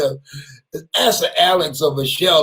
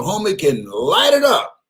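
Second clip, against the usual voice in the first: usual speaking voice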